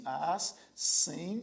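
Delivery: "assembleia" said slowly as as-sem-blei-a, syllable by syllable; split into syllables. Shown as a demonstration. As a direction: stretch "assembleia" out, one syllable at a time. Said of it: "você divide assim". ("assembleia" dividida em sílabas, devagar)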